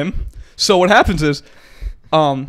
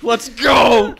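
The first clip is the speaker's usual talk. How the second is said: excited shout; 4 semitones higher, 4 dB louder than usual. Real, not acted. Celebrates the dream.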